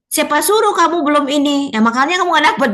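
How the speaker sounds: mock-scold the kid for not trying; scolding adult. Teacher imitating an adult scolding a disappointed child, mildly irritated. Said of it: "Jadi makin dimarahin ya".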